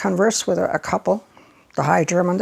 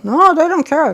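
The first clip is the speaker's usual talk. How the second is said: higher and louder, amazed; excited exclaim; 9 semitones higher, 9 dB louder than usual. Real, not acted. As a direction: exclaim it in disbelief. Describing reckless Germans at tables.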